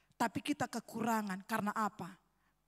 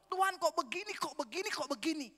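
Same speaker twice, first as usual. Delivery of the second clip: retold complaining; 4 semitones higher than usual. Preacher imitates his own years of grumbling to God, complaining. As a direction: whine to God as if complaining endlessly. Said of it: "Ngeluh terus".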